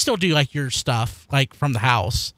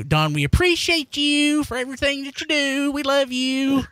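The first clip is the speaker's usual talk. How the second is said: higher, gushing fan voice; sweet fan message; 8 semitones higher than usual. Pretend. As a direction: coo it like an adoring fan. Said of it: "send Don a little bit of something to say".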